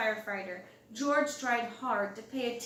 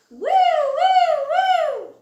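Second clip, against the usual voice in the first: high, loud siren wail; siren horn; 13 dB louder, much slower than usual. A narrator's reading. Narrator voices the fire engine horn, loud high wail. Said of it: "went the horn".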